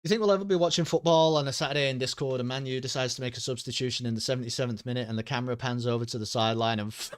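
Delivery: ordinary talk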